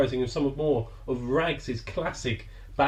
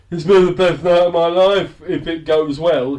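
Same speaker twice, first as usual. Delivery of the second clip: put-on loud slurred drunk boast; drunken slur; 4 semitones higher, 9 dB louder than usual. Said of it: "He slurs into the camera".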